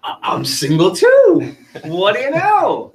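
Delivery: hammed-up smooth operator who can't keep a straight face; mock-cheesy pickup line with a laugh; smug flirting guy. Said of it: "He'll say".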